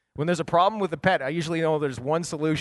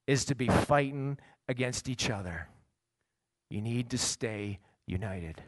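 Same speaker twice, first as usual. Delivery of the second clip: softened plea; 5 semitones lower, 5 dB quieter than usual. Acted. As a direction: drop much softer and lower for the plea. Quiet plea to congregation.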